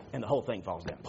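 ordinary talk, nothing marked

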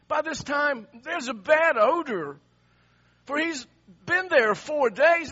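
high, loud, driving sermon delivery; full-force preaching. Acted. Preacher tells congregation of Martha's doubts, high and forceful.

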